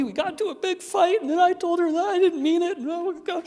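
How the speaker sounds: high-pitched, loud, overwrought whine; mock distress. Someone recounting a big fight with mom, mock upset. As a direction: wail it in hammed-up dismay. Mimicking upset person after failed attempt.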